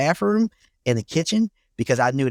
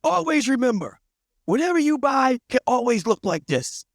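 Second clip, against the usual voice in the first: rich mentor's voice; 14 semitones higher than usual. Retold or imitated. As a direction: hand down wisdom like the big investor. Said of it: "And he talks like this".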